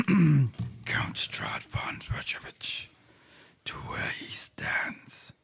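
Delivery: eerie ancient whisper of a dark power; gravelly whisper. Acted. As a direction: hush it out like a creeping voice from the shadows. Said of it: "things got a little quiet and a little gravelly".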